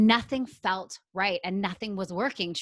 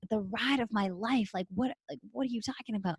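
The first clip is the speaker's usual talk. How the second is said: softer, mildly baffled echo; puzzled disbelief; 3 semitones higher, 4 dB quieter than usual. Retold or imitated. Newcomer questioning the breathwork guide, mildly puzzled. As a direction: replay a quiet, doubtful question.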